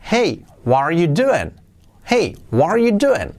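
"Hey", pause, then swooping "what are you doing?"; hey run-up intonation. Shown as a demonstration. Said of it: "aplicamos el hey antes de nuestras preguntas".

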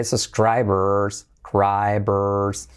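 slow "subscribers" with drawn-out R sounds; key R sound. Shown as a demonstration. Teacher showing the key R, slow stretched "subscribers". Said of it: "Con letra clave R".

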